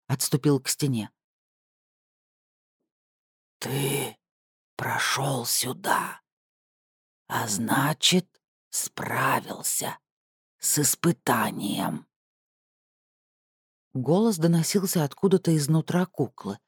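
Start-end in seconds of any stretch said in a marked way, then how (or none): 3.6-12.1 slow, muffled, creaking puppet voice; creaky doll voice. Acted. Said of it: "приглушённый и скрипучий".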